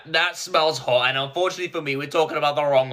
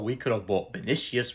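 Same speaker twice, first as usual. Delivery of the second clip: ordinary talk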